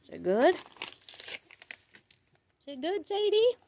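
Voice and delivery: high sing-song dog voice; talking to a dog. Real, not acted. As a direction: ask the dog sweetly, up high. Talking to her dog Sadie.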